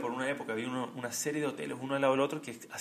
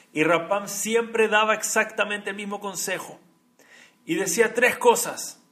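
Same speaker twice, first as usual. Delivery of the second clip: emphatic preaching; 6 semitones higher, 7 dB louder, slower than usual. Acted. Sermón, énfasis en los tres consejos.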